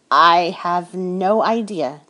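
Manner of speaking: slow, drawn-out admission, leaning on no; slow sheepish admission; slow confession. A narrator's reading. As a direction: confess it slowly and a bit sheepishly. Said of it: "I confessed".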